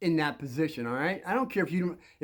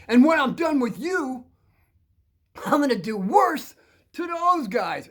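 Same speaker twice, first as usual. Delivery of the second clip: loud, high, emphatic threat; bully's threat; 7 semitones higher, 6 dB louder than usual. Retold or imitated. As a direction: imitate a bully threatening with pauses for effect. Bully threatens kid and friends.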